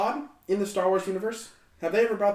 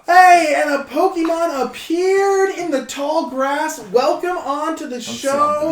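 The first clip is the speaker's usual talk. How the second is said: game announcer; 10 semitones higher, 7 dB louder, slower than usual. Pretend. Game-style announcement of new guest.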